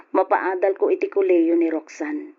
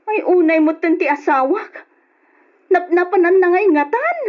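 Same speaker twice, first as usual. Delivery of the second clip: higher, louder exasperated cry; distressed raised voice; upset outburst; 10 semitones higher, 5 dB louder than usual. Acted. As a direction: cry out in frustration. Upset that husband is missing.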